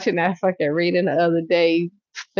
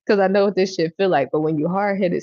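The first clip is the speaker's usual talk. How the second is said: ordinary delivery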